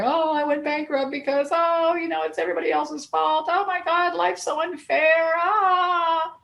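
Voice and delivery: high, whiny, self-pitying moan; mock whining victim. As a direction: whine it like a sulking victim blaming everyone. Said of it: "whine and complain".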